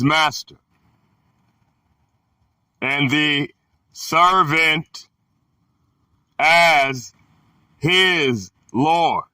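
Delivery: slow, halting phrases with weighty pauses; scripture reading. Acted. Reader giving listeners the words of the Son, solemn scripture reading. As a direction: read it out deliberately, a few words at a time.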